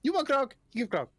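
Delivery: high kobold barkeep; high, broken-English kobold; uncaring kobold. Acted. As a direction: brush it off in a high creature voice. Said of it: "he doesn't really care about his clientele".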